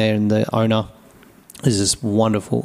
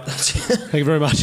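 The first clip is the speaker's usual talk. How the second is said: high, laughing thank you; 5 semitones higher than usual. Retold or imitated. Brewer thanking the bar owner, laughing. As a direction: giggle through the thanks.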